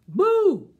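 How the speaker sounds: usual speaking voice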